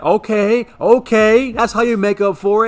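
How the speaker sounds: high, loud teammates whooping it up; excited cheering. Retold or imitated. Teammates hyping the kid after his triple, high and loud cheering. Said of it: "they were like".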